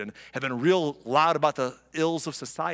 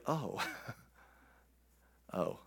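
deflated realization; 8 semitones lower, 14 dB quieter than usual. Retold or imitated. Judah caught out, deflated.

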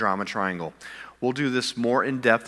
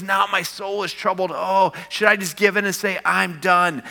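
higher and louder, building fervor; preaching fervor; 8 semitones higher, 4 dB louder than usual. Retold or imitated. Pastor voicing Jesus's struggle to the congregation, fervent. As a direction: preach it with rising passion.

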